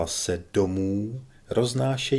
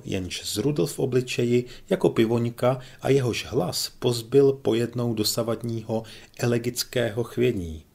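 plain reading voice

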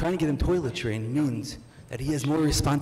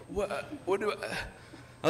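stunned parent; 6 semitones higher, 7 dB quieter, much slower than usual. Retold or imitated. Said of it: "and you're like".

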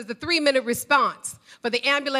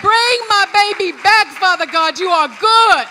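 loud, high, pleading cry to God; wailing prayer; 5 semitones higher, 7 dB louder than usual. Retold or imitated. Mother crying out to God over her dying son, wailing and loud. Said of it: "I called out as a wailing mother".

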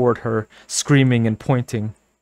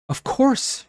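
sudden realization. A narrator's reading. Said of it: "she thought".

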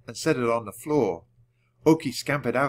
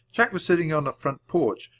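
plain reading voice

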